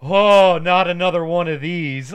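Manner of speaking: loud, hammed-up groan of a tired man; a loud, overdone moan of world-weariness; weary groan. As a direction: act out a tired sigh of dread, loudly. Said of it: "and he's like".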